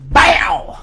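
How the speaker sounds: loud, high "bow!" burst; imitated impact noise. Storyteller acting out his headbutt, loud impact noise. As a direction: explode on the sound of a blow.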